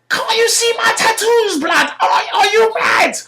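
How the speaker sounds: high falsetto threat; hammed-up shrieking road-man; shrieking roadman. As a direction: squeal the threat way up high and loud. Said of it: "screaming like a woman".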